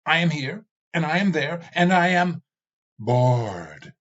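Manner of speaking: glowering Ravana ranting to the skull. Acted. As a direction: brood and seethe over eternity. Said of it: "Ravana glowered".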